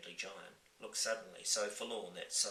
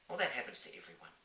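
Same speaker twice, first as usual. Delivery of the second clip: put-on young girl voice; 3 semitones higher, faster than usual. Sophie comforts.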